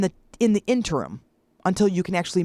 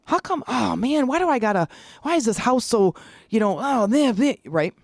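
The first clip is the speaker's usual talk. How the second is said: mock grumbling; 5 semitones higher than usual. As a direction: act out sputtering, fed-up muttering. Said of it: "now I'm mad".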